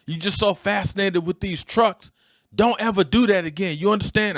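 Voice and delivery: high, loud-ish father's scolding; a dad going off at his son in the car; retold angry scolding. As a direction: scold the boy like a worried dad. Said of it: "He was like".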